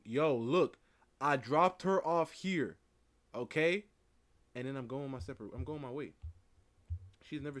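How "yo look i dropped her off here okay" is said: louder, slowed, announcing for the camera; mock video statement. Host pretending to film proof he dropped her off: loud, slow mock announcement. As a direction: call it out clearly for the recording, mock-official. Acting out recording a safety video.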